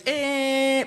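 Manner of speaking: held high monotone tinnitus tone; imitated ear ringing. Showing friends the constant tinnitus, imitated ringing. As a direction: drone out a high ringing noise. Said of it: "It's always".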